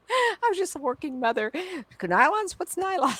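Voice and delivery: naive disbelief. Retold or imitated. Mocking her naive younger self.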